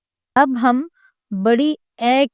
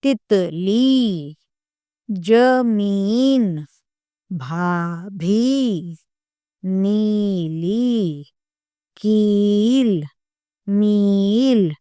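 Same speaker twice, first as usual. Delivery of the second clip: every word stretched slowly for learners to repeat; slow clear teaching; much slower than usual. Shown as a demonstration. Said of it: "इसमें हम लिखते हैं इसके शब्द". (बच्चों को सिखाने के लिए धीरे धीरे बोलना)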